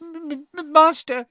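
very high, stuttering elf; pleading, frightened squeak; tearful stammer. A narrator's reading. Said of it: "Winky stammered".

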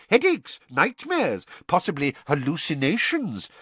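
high, hurried listing; fussy minister. A narrator's reading. Fudge listing Harry's symptoms, high fussy voice.

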